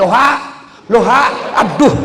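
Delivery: loud, high confused hallo; loud, high puzzled phone yell; yelling into the wrong end. Retold or imitated. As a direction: bellow into the phone, then a frustrated aduh. Old man yelling into reversed phone.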